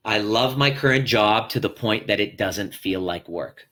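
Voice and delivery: ordinary talk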